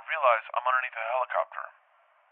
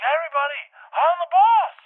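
very high, full-voiced shout; yelling back; 9 dB louder than usual. Dreamer to everyone yelling in Portuguese, yelling back. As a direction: holler over the whole crowd, building louder. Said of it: "I start yelling back".

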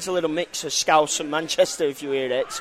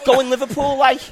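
high, loud Liverpool phrase; scratchy Scouse accent; 7 semitones higher, 6 dB louder than usual. Shown as a demonstration. Pub owner coaching learners, Scouse accent. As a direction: tag it with a Scouse "like". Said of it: "you kinda have the scratch".

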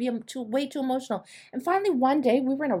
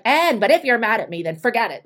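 louder, sarcastic don't-do-this line; mock a snippy ultimatum, louder; louder sarcastic example of what not to say; passive-aggressive example; 8 dB louder than usual. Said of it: "Don't be like".

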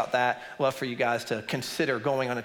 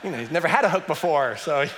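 laughing; 3 semitones higher, 5 dB louder than usual. Real, not acted. Laughing at punchline.

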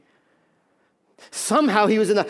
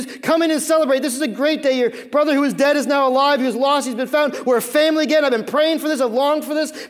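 high, loud, urgent fatherly plea; acted begging; 7 semitones higher, 5 dB louder than usual. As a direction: plead with a stubborn son to join in. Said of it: "He's begging him".

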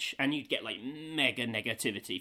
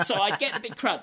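ordinary talk

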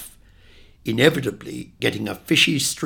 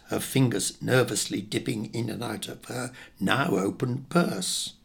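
usual speaking voice

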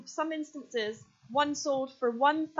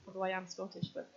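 ordinary talk, nothing marked

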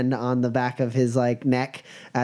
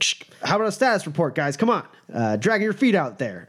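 quick, higher-pitched nagging; impatient radio order; 6 semitones higher, faster than usual. Acted. Guard radios his slack men, acted impatience. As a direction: hurry the men along over the radio.

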